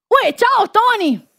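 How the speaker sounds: high cheerful greeting; cheerful shout. Acted. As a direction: hail a friend from afar. Greeting called across the camp.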